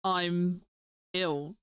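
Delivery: usual speaking voice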